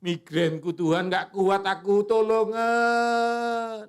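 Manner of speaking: loud, high desperate plea to God; begging God for help with a migraine; pleading prayer. Pretend. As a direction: cry out for help, stretching the last words. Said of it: "Mendingan kamu ngomong gini".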